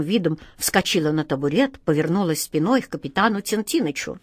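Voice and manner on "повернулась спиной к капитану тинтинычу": usual speaking voice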